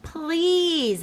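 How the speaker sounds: high, drawn-out begging please; begging child. Acted. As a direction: plead like a little kid. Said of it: "I begged".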